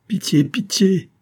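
high, drawn-out begging; imploring cry, pitched high; desperate plea. A narrator's reading. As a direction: beg slowly for mercy. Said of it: "répétait toujours la reine".

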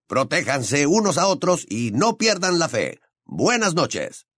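upbeat announcer reading the broadcast close; radio host sign-off. Acted. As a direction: sign off a secret radio show to listeners.

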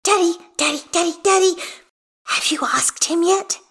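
high, insistent repeated calls; pestering child. A narrator's reading. Hestia tugs at dad's arm, high impatient pestering. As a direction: nag dad in a small child's high voice. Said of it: "Hestia hisses, tugging at his arm".

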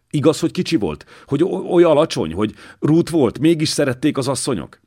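excited stammering. A narrator's reading. Young monk begging the old knight for stories of the king, excitedly stammering and rushing. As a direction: stumble over the words in breathless eagerness. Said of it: "Dénes izgatottan fordult felé. Akadt a nyelve".

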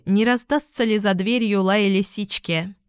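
ordinary delivery, nothing marked

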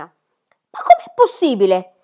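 very high, loud scandalised cry; rising in shock at each answer; appalled governess. Acted. Rottenmeier reacts to the child's age with rising outrage.

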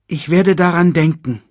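put-on high, loud young voice; earnest cub. A narrator's reading. Cub promising his father to remember, high and earnest.

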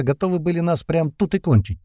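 plain reading voice

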